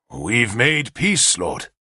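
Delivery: dark voice. Acted. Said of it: "in his dark voice".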